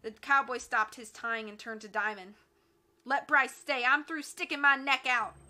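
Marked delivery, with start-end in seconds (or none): 3.0-5.4 loud, raised defiant complaint; rebellious refusal. Acted. Cowboy henchman refuses his boss's order, loud and fed up. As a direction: balk loudly at the boss.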